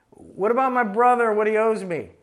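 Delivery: hammed-up grievance from the crowd; indignant heckler. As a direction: play the petty man cutting in. Voicing the man in the crowd.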